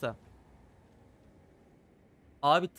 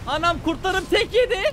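shocked outcry; 8 dB louder than usual. Real, not acted. Shocked by one-hit kill.

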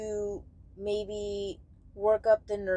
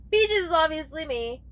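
playful; 9 semitones higher, 7 dB louder than usual. Real, not acted. Joking about channel name.

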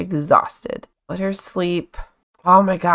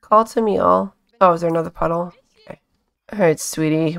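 ordinary delivery

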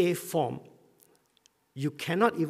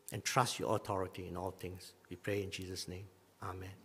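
closing prayer; 5 semitones lower than usual. Acted. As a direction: pray gently to close the sermon. Pastor leads closing prayer.

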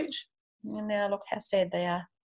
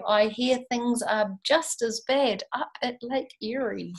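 lively read-aloud rhyme; storybook reading voice; story narration; 3 semitones higher, 8 dB louder, slower than usual. A narrator's reading. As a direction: read it to a class with bounce. Reads Dr. Seuss aloud.

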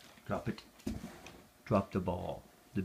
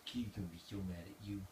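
much softer, murmured teasing of the dog; 10 dB quieter than usual. Real, not acted.

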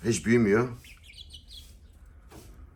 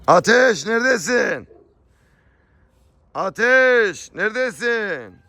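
high, drawn-out holler; calling out; 13 semitones higher, 5 dB louder, much slower than usual. Real, not acted. Owner searching for the animal Ateş, long high calling shout. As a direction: holler for a missing animal, stretching the words.

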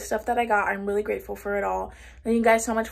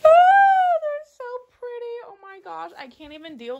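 overwhelmed near tears; 14 semitones higher, 9 dB louder than usual. Real, not acted. Gift pillows from best friend.

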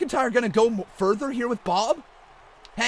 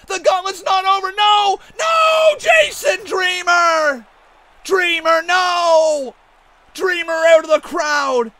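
higher, louder frantic yelling; shocked protest; 5 semitones higher, 5 dB louder than usual. Acted. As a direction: beg the interloper not to do it. Shock at surprise entrant.

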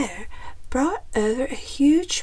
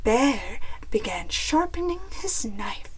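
plain reading voice

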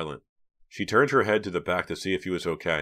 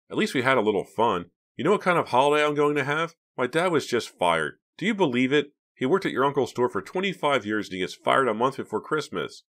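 higher, agitated retort; aggrieved, pitched-up complaint; upset venting; 7 semitones higher, 4 dB louder than usual. A narrator's reading. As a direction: pour out a grievance to a friend. Boy venting about father's firing.

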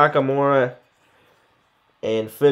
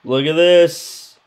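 normal talking voice

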